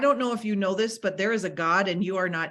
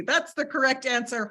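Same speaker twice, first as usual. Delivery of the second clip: ordinary talk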